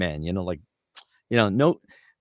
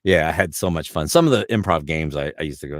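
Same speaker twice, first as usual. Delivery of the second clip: ordinary talk, nothing marked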